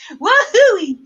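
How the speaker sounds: high, loud celebratory whoop; excited whoop. Pretend. Host proving she can say wahooey, excited whoop. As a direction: let out a big joyful woohoo. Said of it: "I can say wahooey".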